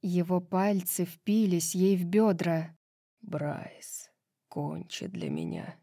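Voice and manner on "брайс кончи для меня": very low, hushed intimate plea; barely voiced, deep murmur; whispered. A narrator's reading. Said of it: "прошептал Хант".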